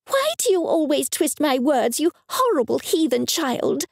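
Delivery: high, scolding mother's voice; furious religious mother. Acted. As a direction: berate the child in indignation. Angry mother tirade.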